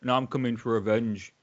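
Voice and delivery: put-on a lightly done Manchester accent, wrestling promo cadence. A Hull guest doing British Bulldog's Manchester promo for the hosts. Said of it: "a guy from Hull doing a Manchester accent".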